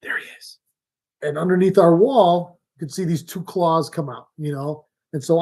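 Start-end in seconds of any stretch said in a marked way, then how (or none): 0.0-0.6 hushed whisper, not to scare him. Retold or imitated. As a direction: breathe it out unvoiced. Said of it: "all of a sudden she goes".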